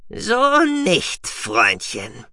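high, loud start, dropping at the end; Guki's rebuke. A narrator's reading. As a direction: rebuke in a piping creature voice. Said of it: "murmelte Guki". (hohe Mausbiberstimme, tadelnd)

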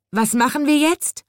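high, pitiful wail; plaintive cry. A narrator's reading. Soaked chimney sweep asking the birds, plaintive and high. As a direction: whimper it out, soaked and helpless. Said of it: "rief er kläglich".

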